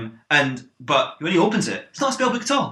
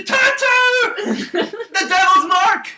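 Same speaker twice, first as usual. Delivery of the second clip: high, loud drawn-out shriek; father recoiling from a tattoo; hysterical father; 5 dB louder, much slower than usual. Acted. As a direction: wail it like a terrified preacher.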